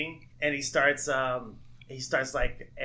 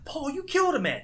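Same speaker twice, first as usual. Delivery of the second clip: mock accusation; 13 semitones higher than usual. Quoting movie scene.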